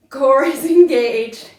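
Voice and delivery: laughing while straining, slow; strained laugh mid-sentence; laughing through strain. Real, not acted. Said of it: "I am shaking".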